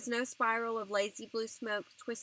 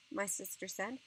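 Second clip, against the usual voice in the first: ordinary delivery, nothing marked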